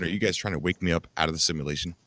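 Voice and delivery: ordinary talk, nothing marked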